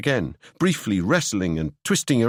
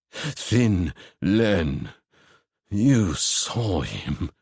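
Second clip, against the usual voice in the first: low, very slow dread; grim Ewan voice; 3 semitones lower, much slower than usual. Acted. Ewan grimly naming Thin Len to Seb, slow dread. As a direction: let the name sink with dread.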